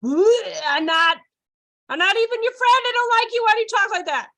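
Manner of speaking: high, loud mock disgust; recoil, then protest high and loud; repelled kid. Kid rejecting the circle-time voice, loud mock repulsion. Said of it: "my temperament's like".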